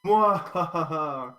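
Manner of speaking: slow theatrical evil laugh; villain laugh. Acted. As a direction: cackle like a gloating villain. Player gloats as villain.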